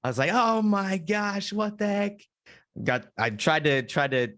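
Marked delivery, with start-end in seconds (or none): 0.3-2.2 high, drawn-out startled cry; panicked yelp. Retold or imitated. To himself, startled by a wasp: high panicked cry.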